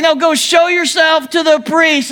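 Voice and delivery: high, insistent shout leaning on show; Jesus correcting the confused lepers with an insistent shout; firmer repeat shout. Retold or imitated. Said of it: "He's like".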